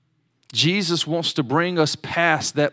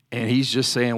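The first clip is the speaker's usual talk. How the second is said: normal talking voice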